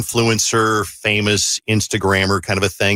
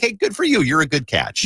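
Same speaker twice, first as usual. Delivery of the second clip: bright congratulations; 5 semitones higher than usual. Retold or imitated. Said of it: "I'd be like".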